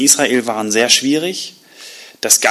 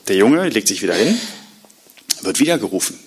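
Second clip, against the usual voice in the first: normal talking voice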